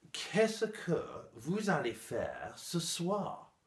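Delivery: laboriously careful classroom recitation; mock textbook French. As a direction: spell out each word like a formal French teacher. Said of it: "mon prof affreuse de français m'a dit".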